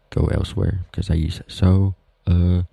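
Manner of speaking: normal talking voice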